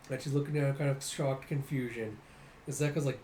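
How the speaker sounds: ordinary talk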